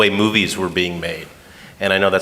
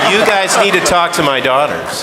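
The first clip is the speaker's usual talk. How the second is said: laughing; 10 semitones higher, 5 dB louder than usual. Real, not acted. Guest laughing while talking.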